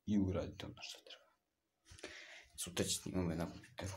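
usual speaking voice

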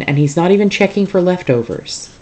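ordinary delivery

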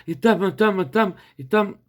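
same words ticked off like a drumroll; mock rattled-off delivery. Shown as a demonstration. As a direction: rattle through it all on one note, mocking the rush. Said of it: "Зараз просто щось таке".

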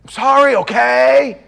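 loud impatient sorry, okay; loud fake apology. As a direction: shout the sorry like an annoyed kid. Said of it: "It wasn't".

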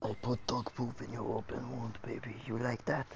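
mock sultry whisper. Taunting friend with gross joke.

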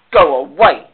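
loud, low, grumpy dismissal. A narrator's reading. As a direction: send them off with low-pitched grumpiness. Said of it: "said the heap grumpily".

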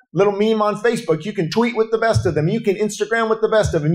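ordinary talk

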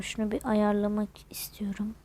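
soft unvoiced whisper. Real, not acted. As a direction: whisper it under your breath. Quietly adjusting something mid-game.

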